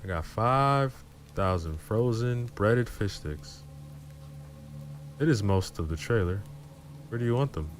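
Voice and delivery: a casual driver with a hint of drawl; slight drawl. A narrator's reading. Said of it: "he said in a little drawl".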